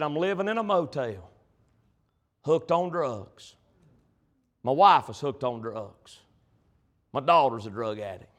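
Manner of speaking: low, subdued retelling of a broken man; retold grim confession. Dying friend confesses his ruined life.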